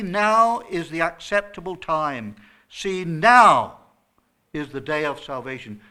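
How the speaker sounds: now punched hard and loud both times; preacher hammering the word now; stress on NOW. Shown as a demonstration. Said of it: "The emphasis is on the word now".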